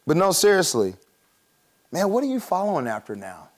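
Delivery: normal talking voice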